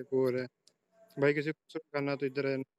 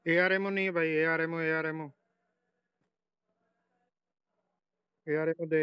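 urgent plea; 3 semitones higher, 4 dB louder than usual. Real, not acted. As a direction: beg for ammo, urgent. Out of ammo, urgent. (घबराहट में गोली माँगना)

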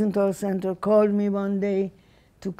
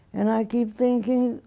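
usual speaking voice

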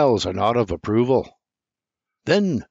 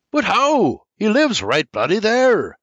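protesting; 10 semitones higher, 5 dB louder than usual. A narrator's reading. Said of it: "I says".

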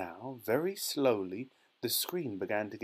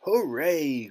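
loud, raised cheer; jubilant cry; 5 semitones higher, 8 dB louder than usual. A narrator's reading. Wonka celebrates the boy's safe arrival, jubilant cry. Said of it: "cried Mister Wonka".

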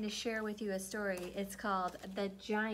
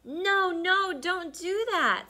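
high, loud alarmed "no, no"; alarmed plea; 9 semitones higher, 8 dB louder, slower than usual. Acted. Frightened animals begging Mama Frog to stay away. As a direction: beg her to stop, alarmed. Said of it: "said the other animals".

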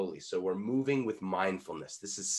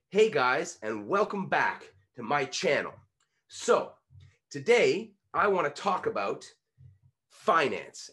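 louder vlog intro, hitting "guys", "back", "finance"; punched key words; 3 semitones higher, 4 dB louder, slower than usual. Shown as a demonstration. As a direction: punch "today" and "finance" between pauses. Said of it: "then you're practicing punching it".

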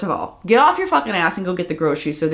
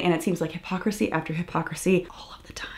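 frustrated whisper; 2 semitones lower, 9 dB quieter, faster than usual. Real, not acted. Frustrated rant trails off.

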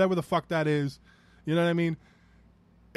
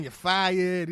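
slow, put-on Trump voice saying his catchphrase; a mild sneering Trump impersonation; mocking Trump. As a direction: drag out "you're fired" like a TV boss. Trump catchphrase mocked in rant.

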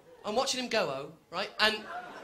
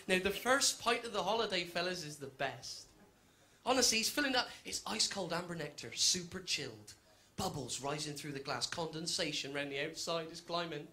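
hushed reverent beer description; savouring; 7 semitones lower, 7 dB quieter than usual. Acted. Lingering over the perfect pint.

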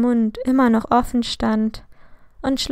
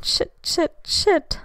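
panicked curse; 7 semitones higher, slower than usual. A narrator's reading. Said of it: "Entsetzt sah sie".

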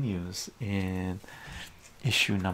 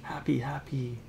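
ordinary delivery

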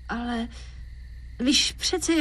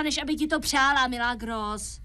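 usual speaking voice

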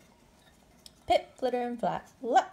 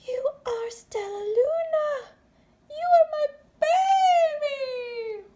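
high, slow tearful joy; overjoyed crying mother; 9 dB louder, slower than usual. Acted. Mother Bat recognising Stellaluna, tearful joy. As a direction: cry out in relief at finding her baby.

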